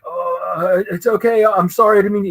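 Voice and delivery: high-pitched, stumbling apology; flustered, embarrassed. Retold or imitated. Fan apologizing to the artist he scolded, flustered and embarrassed. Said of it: "I'm like, so I'm embarrassed".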